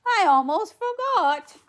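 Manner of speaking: loud, high exclamation; fairy godmother. A narrator's reading. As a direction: blurt it out as the flustered fairy. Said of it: "cried the fairy godmother".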